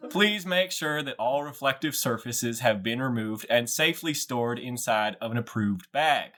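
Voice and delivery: put-on flight attendant voice; pre-flight announcer. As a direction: recite grim warnings like routine cabin procedure. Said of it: "you get a pre-flight announcement".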